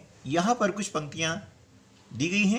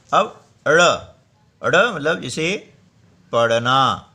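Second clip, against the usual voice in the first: पढ़ना said loud with a clear flap; loud emphatic demo; 8 dB louder, slower than usual. Shown as a demonstration. Scene: teacher demonstrates the sound to students, loud emphatic. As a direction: announce अड़ loudly and hit पढ़ना. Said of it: "ऐसे बोलेंगे".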